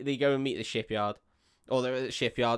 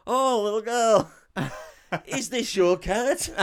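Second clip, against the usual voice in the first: high, loud, slow showman; hammed-up magician reveal; 8 semitones higher, 5 dB louder, slower than usual. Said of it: "And then it's just like".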